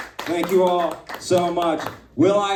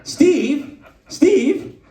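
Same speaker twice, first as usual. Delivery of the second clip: high, louder disbelieving call; mock shocked; 9 semitones higher, 4 dB louder than usual. Host calling out his insulting sidekick, mock shocked. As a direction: call the name high, as if betrayed.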